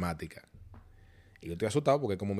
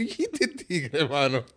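laughing disbelief; much slower than usual. Retold or imitated. Reacting to the odd classmate, laughing disbelief. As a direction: laugh through the question.

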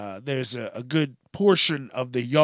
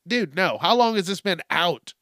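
ordinary delivery

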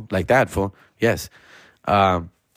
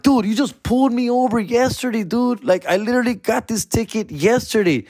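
high-pitched, whiny reenacted complaint; incredulous protest; 12 semitones higher than usual. Driver reminding the cop about yesterday's ticket, incredulous protest. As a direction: plead your case in a raised pitch.

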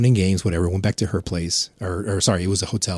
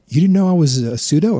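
higher, louder teasing question; woman at the bar; 8 semitones higher, 7 dB louder than usual. Retold or imitated. She asks whether he knew, higher and teasing. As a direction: needle him a little. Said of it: "she goes".

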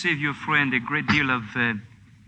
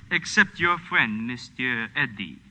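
slow, pointed; 2 semitones lower, 3 dB quieter, slower than usual. Acted. Officer reveals they hold Eddie.

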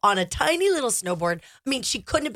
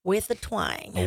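slow Southern twang, drawn out; Tennessee twang; 3 semitones lower, 4 dB quieter, much slower than usual. Shown as a demonstration. Jokes about picking up accents.

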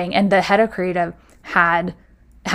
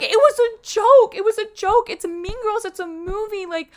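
much higher, strained tearful defence, fading softer; desperate pleading; 12 semitones higher, 3 dB quieter than usual. Retold or imitated. To HR about the burn book, a high desperate plea. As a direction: beg them to understand, voice up high.

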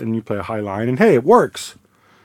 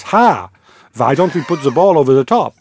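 higher, louder put-on German accent; bad German accent; 4 semitones higher, 6 dB louder than usual. Pundit quoting Klopp's retort, bad German accent. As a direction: quote it in a hammy German accent. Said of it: "do a bad German accent".

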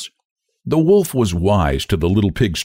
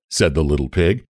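plain reading voice

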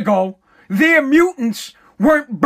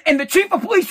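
usual speaking voice

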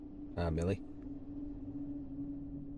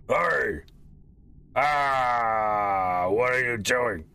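distant gruff shouting; much slower than usual. A narrator's reading. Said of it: "you do hear this voice shouting in the distance".

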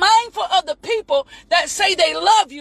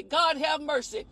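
softer, lower aside to God; muttered aside; 3 semitones lower, 9 dB quieter than usual. Real, not acted. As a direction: drop it under your breath.

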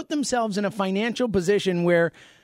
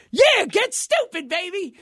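hyped-up owner; 13 semitones higher, 3 dB louder, slower than usual. Pretend. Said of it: "just saying".

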